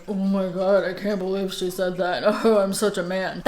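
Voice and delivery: a hint of a mocking man's voice; mocking male voice. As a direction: lightly parody a guy quoting a friend. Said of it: "unless I'm mocking somebody".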